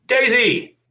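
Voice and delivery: high, loud yell of a name; furious high-pitched holler; angry shout. A narrator's reading. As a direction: call out in anger from far away. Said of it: "The angry voice sounded very familiar".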